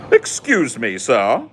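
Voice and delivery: high-pitched mock British indignation; offended Brit. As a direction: play a scandalized, easily wounded Brit. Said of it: "you poke one little thing at them and they're like".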